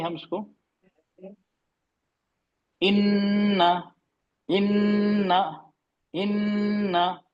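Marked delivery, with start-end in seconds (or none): 2.8-7.2 इन्ना with the nn held long and nasal; ghunna on nn. Shown as a demonstration. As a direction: hum the नून in इन्ना through the nose, slowly. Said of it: "क्या कर रहे हैं इसमें? गुन्ना कर रहे हैं".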